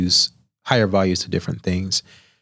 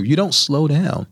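ordinary talk, nothing marked

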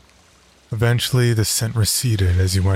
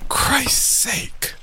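urgent hissed whisper. A narrator's reading. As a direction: breathe it out in shock. Whispered exclamation.

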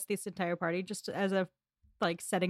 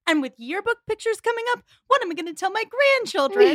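hammed-up high, loud wail; mock-shriek it like a drama queen; theatrical outrage, pitched way up; overdramatic Dionne; 13 semitones higher, 8 dB louder than usual. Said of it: "She is so overdramatic".

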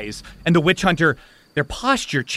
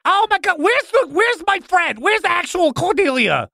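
exasperated spirit; 13 semitones higher than usual. Acted. Spirit hunts for the real Cordelia, wailing. Possessor looks for its friend.

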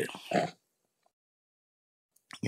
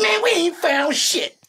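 much higher, slow, deadpan soldier; slower than usual. Retold or imitated. Retelling the pick-comb soldier's answer in a slow high voice. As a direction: drawl it out flat. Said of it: "They said".

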